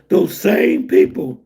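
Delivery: ordinary talk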